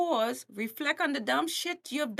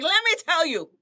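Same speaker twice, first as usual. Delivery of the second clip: louder, higher preacher scolding; T D Jakes scolding; 5 semitones higher, 6 dB louder than usual. Retold or imitated. Preacher shaking up a sinner, loud rebuke. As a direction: shake the listener with a preacher's rebuke. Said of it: "a T D Jakes to shake you and be like".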